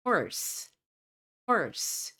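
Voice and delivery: American "horse" with a strong r; American pronunciation. Shown as a demonstration. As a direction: say horse with the r fully sounded. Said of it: "o R tem uma pronúncia mais forte".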